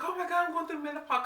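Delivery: high, fast half-laughing panic; mock panic. Woman joking about menopause scare, high fast mock panic. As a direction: replay the fear, half amused. But I'm like.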